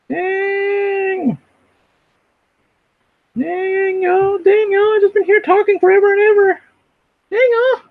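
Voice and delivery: very high, loud squealed impression; Vocab excited. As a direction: mimic an excited streamer in a piercing high pitch. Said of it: "That's my impression of Pokemon, 'cause you know when he gets excited".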